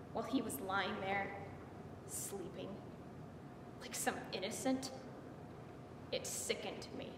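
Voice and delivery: low, soft recollection; hushed disgust. Acted. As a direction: let the voice sink away in revulsion. Recalls the sleeping man with disgust.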